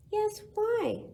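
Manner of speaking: high calling voice; calling dingoes. Acted. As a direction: call up to the tree, coaxing. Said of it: "called the Dingoes".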